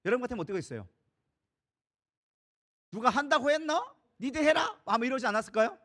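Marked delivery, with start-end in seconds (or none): 2.9-4.8 higher, louder retort; defiant refusal. Acted. Overwhelmed new leader refusing the complaining people, defiant retort. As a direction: throw the job back at them. Acts out reluctant leader snapping back.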